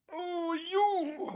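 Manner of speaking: high-pitched affectionate coo; doting pet coo. Real, not acted. Streamer fussing over his dog, doting coo.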